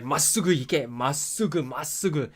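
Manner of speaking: a hint of a stern Japanese driving instructor; strict instructor. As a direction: bark the order like the strict instructor. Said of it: "the instructor was like".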